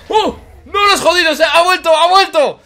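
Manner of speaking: loud joyful relief; excited shout. Real, not acted. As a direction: cry out happily.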